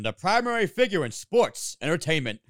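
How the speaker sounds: deliberate wrestling announcer tagline, spaced out; signature announcer voice. Retold or imitated. Co-host recalls nineties wrestling tagline, announcer impression. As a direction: imitate a nineties promotional voice-over. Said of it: "the signature was".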